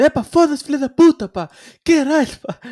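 very high, very loud swearing spree; mock furious cursing. S1 imitating David swearing at home: furious mock rant. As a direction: explode in curses like David.

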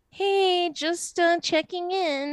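high, tentative little check-in; mock apologetic high voice; meek, minimizing. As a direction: shrink yourself with a timid, sing-song ask. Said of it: "it minimizes you".

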